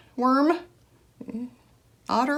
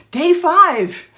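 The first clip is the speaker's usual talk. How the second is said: laughing through day five, higher and louder; 8 semitones higher, 7 dB louder than usual. Real, not acted. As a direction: giggle while saying it. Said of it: "No, it's day five".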